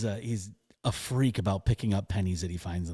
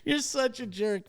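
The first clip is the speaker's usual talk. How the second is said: laughing tease; 13 semitones higher than usual. Retold or imitated. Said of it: "And I was like".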